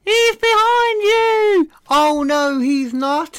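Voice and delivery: very high, loud shrieking panto call; panto crowd callback. Pretend. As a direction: yell it like a panto audience. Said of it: "That's how pantomimes go".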